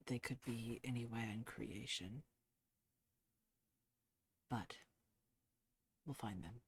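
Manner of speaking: soft and low, fading to near silence; gentle reassurance. Acted. Clement, expression softening, quietly reassuring grieving Tagok. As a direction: comfort her, trailing away.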